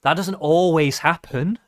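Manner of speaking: ordinary delivery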